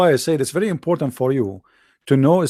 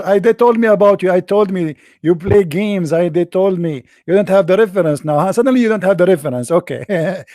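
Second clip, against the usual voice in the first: gloating caller; 3 semitones higher, 5 dB louder than usual. Retold or imitated. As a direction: gloat and call him liar.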